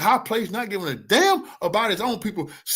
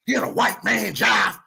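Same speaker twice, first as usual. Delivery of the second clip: slow, mocking parody shout; mock politician; slower than usual. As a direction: sneer out the slogan slowly, like a bad speech.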